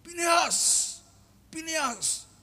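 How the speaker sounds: high calling from afar. Retold or imitated. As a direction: call his name across the harbour. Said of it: "ada yang manggil-manggil". (memanggil dari jauh dengan suara tinggi)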